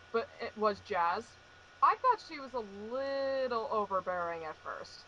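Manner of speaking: ordinary talk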